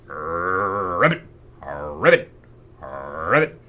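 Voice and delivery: deep low croaking ribbits; bullfrog croak. Pretend. Showing what the bullfrog sound is, low croak.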